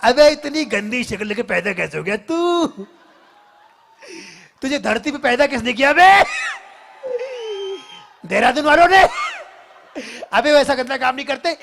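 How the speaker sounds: loud, high comic yelling; hammed-up comic actor rant; Johnny Lever impression. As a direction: shout the insults in a goofy comic rant. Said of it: "Johnny liver Saheb".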